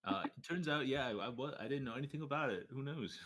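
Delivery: high, loud, rushed nervous denial; flustered. Acted. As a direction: babble it out, caught off guard.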